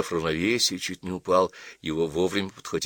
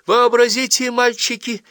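much higher, louder, gushing; girl gushing the news to the boys, breathless; breathless excitement; 6 dB louder than usual. Acted. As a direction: rush it out, choking with excitement. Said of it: "захлёбываясь, сообщила она".

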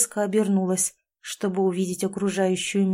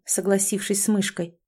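ordinary delivery

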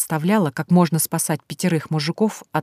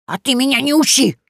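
high, loud retort; angry old witch; 8 semitones higher, 5 dB louder than usual. Acted. Yaga snaps at Vasilisa, high and loud. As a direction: snap at the girl.

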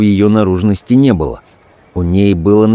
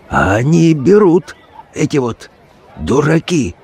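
creaky whisper; 6 semitones higher than usual. A narrator's reading. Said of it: "надтреснуто шепнул я спутнику".

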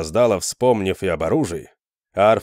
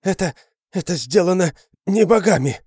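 breathless, higher and frantic; panting shaky eyewitness; 10 semitones higher, 3 dB louder than usual. Acted. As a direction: gasp it out in terror. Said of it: "задыхаясь, проговорил Арфас".

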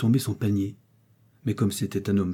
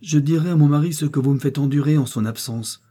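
higher, louder, tense threat; pale with anger; 6 semitones higher, 5 dB louder than usual. A narrator's reading. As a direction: warn her in rising anger. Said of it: "devenant de plus en plus pâle".

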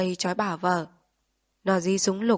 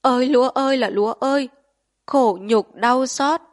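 wailing lament; 5 semitones higher, 8 dB louder than usual. A narrator's reading. Man mourning his trampled rice, loud anguished wail. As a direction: cry out to the sky in grief. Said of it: "ngửa mặt lên trời khóc".

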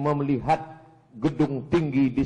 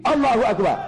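loud, high rallying cry; rousing takbir; 7 semitones higher, 5 dB louder, faster than usual. Real, not acted. Preacher ends point before congregation, loud rousing cry. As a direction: call it out to rouse the crowd.

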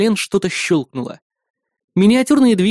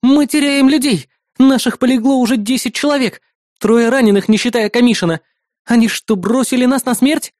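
high, louder outburst from a woman fighter; indignant protest; 8 semitones higher, 4 dB louder than usual. Acted. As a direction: protest urgently at being abandoned. Woman fighter protesting mounting losses.

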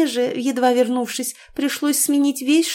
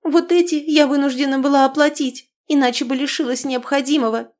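high, louder accusing outburst; accusing cry; 6 semitones higher, 3 dB louder than usual. A narrator's reading. Niece accusing husband over debts, mock outcry. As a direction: throw the blame at him. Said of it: "обвиняюще выкрикнула".

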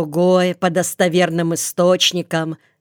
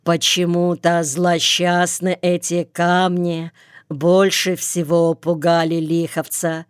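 usual speaking voice

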